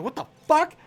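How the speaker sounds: high, loud, shocked what the fuck; jolted awake and yelling; startled outburst. Retold or imitated. Woken by a door forced open, shouting in shock. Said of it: "And I'm like".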